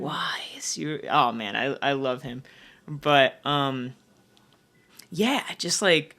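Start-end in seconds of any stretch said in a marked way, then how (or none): 0.0-1.0 whispered retort. Retold or imitated. Her retort, suddenly whispered.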